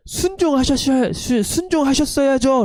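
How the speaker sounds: high, reproachful 순종하셨어야죠 with stress on 순종; indignant rebuke. Acted. Samuel reproaching Saul, high and indignant. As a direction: scold sharply, pitched high. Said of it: "그걸 하나님이 기뻐하시는 게 아닙니다".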